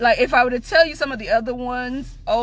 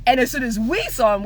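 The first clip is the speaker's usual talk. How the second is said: ordinary delivery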